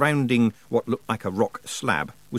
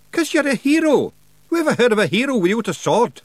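high, broad Scots wee man, cheeky and gruff; a higher pitched Scots brawler voice; Scottish pictsie Rob; 12 semitones higher, 6 dB louder than usual. Acted. Pictsie chief thanking nobody for thanks, in a high Scots voice.

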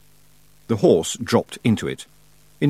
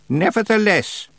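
put-on stern old witch; 11 semitones higher than usual. A narrator's reading. Miss Treason, slow, high.

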